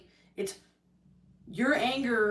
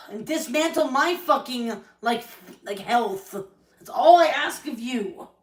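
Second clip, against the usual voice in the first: higher and louder, nearly shouting; angry plea; 3 semitones higher, 6 dB louder than usual. Real, not acted. To Corey, demanding he stop hurting her, loud angry plea. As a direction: push it out, fed up and hurt. Pleading with brother in angry rant.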